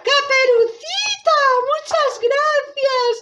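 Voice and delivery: very high, drawn-out granny voice; grateful grandmother. Acted. Grandmother freed from the wardrobe, high joyful voice. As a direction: squeal thanks in high relief. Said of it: "apareció la abuelita".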